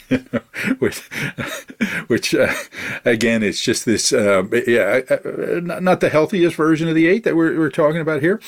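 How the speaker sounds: high laughing voice through the words. Real, not acted. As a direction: laugh while talking. Laughing after Conan quote.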